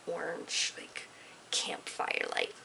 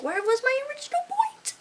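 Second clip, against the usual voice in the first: much higher and louder, lost and baffled; mock bewildered; 5 dB louder than usual. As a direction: squeak it out like you've completely lost the thread. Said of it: "and I'm like".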